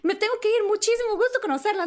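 high, fast panicky babble; mock frantic goodbye; flustered self. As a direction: hurry to escape. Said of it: "Fue así de".

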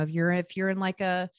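ordinary talk, nothing marked

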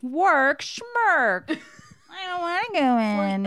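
high, loud, bratty put-on whine; mock hungover whine. Hungover friend refusing work, high bratty whine. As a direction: whine it like a spoiled partier. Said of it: "And then I was like".

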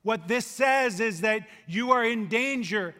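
usual speaking voice

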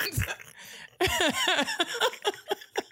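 very high laughing fit; real high squealing laugh; real laughter. Real, not acted. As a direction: lose it laughing, high-pitched. Laughing at silly captions.

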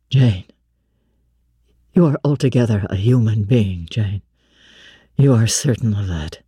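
a blind man reaching for reassurance, low and searching; low, hushed questioning man; Rochester, tender doubt. Acted. Narrator voices Rochester.

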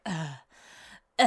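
drawn-out mock groan of reluctance; put-on exasperated "ugh"; imitated grudging groan.

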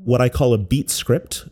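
usual speaking voice

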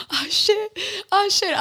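spiral into mock panic; panicked. Lying awake hearing noises, panicking to herself. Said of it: "I was really getting in my head".

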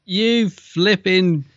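high, slow posh mock insult. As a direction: drag it out like a prim gent. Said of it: "I sound like Moss from the I T crowd".

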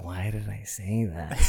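very soft, drawn-out regret; slow, quiet cringe at oneself; mortified self-reproach. Retold or imitated. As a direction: say it under the voice, mortified. Retold regretful thought after blunder.

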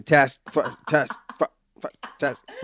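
stuck repeating "test, fu-"; mock broken-record stutter on "test, fu-"; imitated verbal loop. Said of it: "That's the loop Negin gets stuck in".

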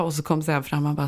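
ordinary talk, nothing marked

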